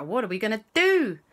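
ordinary delivery

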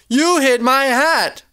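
high, slow accusation; really mad. A narrator's reading. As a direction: accuse her, drawing the words out. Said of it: "I am really mad".

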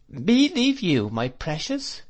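high, drawn-out incredulous exclamation; slow, astonished outcry to someone dear; crying out. A narrator's reading. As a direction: protest with fond disbelief, pitch soaring. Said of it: "cried Peggotty".

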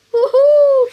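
loud, high whoop of delight; excited whoop. Real, not acted. Gamer cheering a great play, excited whoop. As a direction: cheer the play like you just won.

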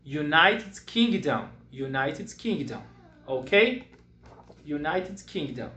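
slow, clear United Kingdom; teacher drilling a country name; slow model word. Shown as a demonstration. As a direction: drill it slowly for repeating.

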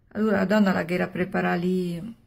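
plain reading voice

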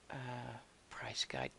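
under-the-breath whisper. Real, not acted. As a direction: whisper it to yourself. Muttering to himself at the game.

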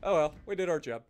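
usual speaking voice

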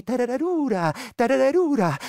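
high, loud mocking trill; shrieking creature call. The vrykolakas mocks the shepherd's swing, high loud trill. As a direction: cackle it out in triumph.

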